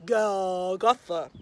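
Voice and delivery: low, slow, deep daddy Golgotha. Shown as a demonstration. Teacher shows pre-K a daddy voice, low and slow. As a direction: say it like a big deep-voiced dad. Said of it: "in a daddy voice".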